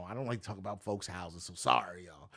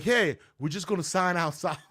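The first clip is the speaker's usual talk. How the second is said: loud, high warning call; gruff warning; 8 semitones higher, 9 dB louder than usual. Retold or imitated. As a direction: shout it from the porch. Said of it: "he comes out with a gun".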